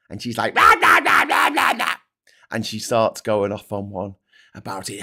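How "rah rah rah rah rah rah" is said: high, harsh nonsense yapping; hammed-up squawking rant; mock ranting. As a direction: imitate an angry tirade as noise. Imitating Rees-Mogg's ranting reply.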